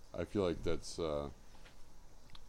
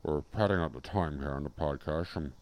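low, soft Scottish impression; Sean Connery; 5 semitones lower, 5 dB quieter than usual. Padding out the podcast, low Scottish impression. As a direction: put on a low Scotsman's voice. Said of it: "I'm Sean Connery of Scotland".

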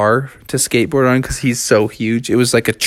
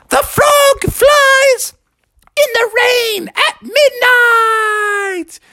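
very high, slow, stretched cry; hammed-up drawn-out triumphant call; grand proclamation; 3 dB louder, much slower than usual. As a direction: wail it out like a soaring hero. Flying frog proclaims grandly.

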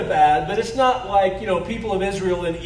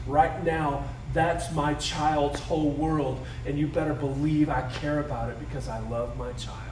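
tender loving God; 6 semitones lower, 6 dB quieter than usual. Retold or imitated. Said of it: "he looks at you like the child".

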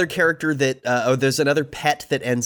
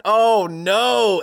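higher-pitched drawn-out horrified groan; mock dismay; 7 semitones higher, 3 dB louder than usual. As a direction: lament it with playful horror. Acted dismay at tragic find.

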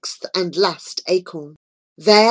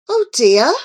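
sighing; 4 semitones higher, 5 dB louder than usual. A narrator's reading. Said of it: "sighed Joy".

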